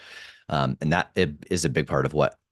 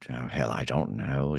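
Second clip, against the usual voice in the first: put-on low, soft old tree wizard; 5 semitones lower, 6 dB quieter than usual. Shown as a demonstration. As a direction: sink the wizard's catchphrase down quietly. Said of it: "say it different ways".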